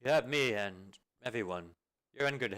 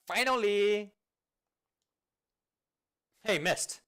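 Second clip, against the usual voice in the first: excited shout; 10 semitones higher, 8 dB louder, slower than usual. Acted. Long wait finally ends.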